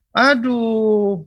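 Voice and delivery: high, drawn-out eastern Indonesian exclamation; expressive eastern accent. Acted. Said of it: "orang-orang sana ekspresif". (logat timur yang ekspresif)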